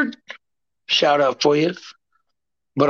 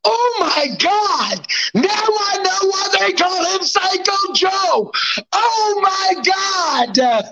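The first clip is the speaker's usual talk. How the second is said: mock shocked rivals; 12 semitones higher, slower than usual. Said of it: "They go".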